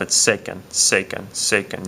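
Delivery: sequen said as SE-quen, first syllable stressed. Shown as a demonstration. Teacher repeating sequen so students hear the stress. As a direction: lean on the E in sequen. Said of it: "You can hear that the stress is right there on that E".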